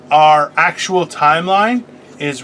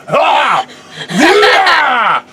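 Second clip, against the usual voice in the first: excited yell; 10 semitones higher, 4 dB louder than usual. Real, not acted. Excited outburst mid-banter.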